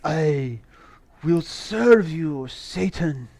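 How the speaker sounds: slow, solemn pledge with pauses; mock devout servant. Reviewer playing Rasputin pledging himself to Satan, slow and solemn. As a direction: swear yourself over slowly, mock-reverent. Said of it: "he was like".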